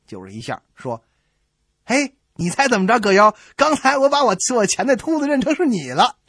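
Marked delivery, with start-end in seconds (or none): none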